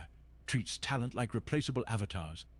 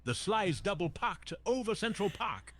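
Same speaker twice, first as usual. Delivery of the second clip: raised-pitch thick Bronx cadence; thick New York accent; 7 semitones higher than usual. Shown as a demonstration. Santa showing a streamer his New York, thick high-pitched Bronx accent. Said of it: "a really thick New York accent".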